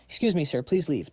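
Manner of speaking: ordinary delivery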